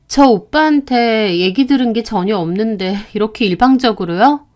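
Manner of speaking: plain reading voice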